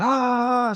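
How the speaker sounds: high mock scream from sister; a quick high imitated scream; sister's shriek, imitated; retold scream. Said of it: "she was screaming".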